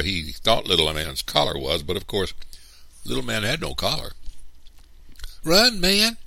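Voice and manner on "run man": put-on loud, very high child's yell; urgent shout. A narrator's reading. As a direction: yell at your brother to flee. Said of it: "Christopher John and I shouted".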